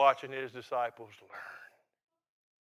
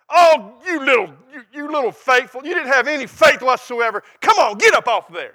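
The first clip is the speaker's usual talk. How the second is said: high, loud scolding yell; mock harsh parent; 9 semitones higher, 13 dB louder than usual. Parent yelling at a fallen toddler, mock harsh scolding. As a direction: berate a toddler who fell, hammed up. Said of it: "Is that the way you talk to those kids?".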